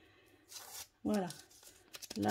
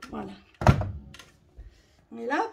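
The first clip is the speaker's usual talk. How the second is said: ordinary talk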